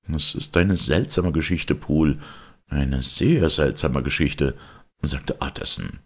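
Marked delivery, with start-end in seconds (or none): none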